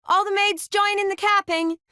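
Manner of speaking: high-pitched call to a group. Acted. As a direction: sing out an announcement to the guests. Calling everyone into the capping.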